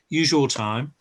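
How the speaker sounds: usual speaking voice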